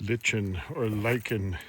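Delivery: first "lichen" as LITCH-en, second "lichen" as LIKE-en; "lichen" short i with ch, then "lichen" long i with hard k; two pronunciations. Shown as a demonstration. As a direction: say "lichen" to rhyme with kitchen, then "lichen" like liken. Gives both pronunciations in turn.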